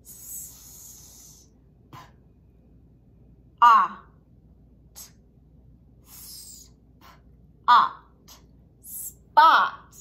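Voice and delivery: whispered "S", "p", "a", "t", then "spot" loud; sounding out spot. Shown as a demonstration. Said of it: "All right, ready?".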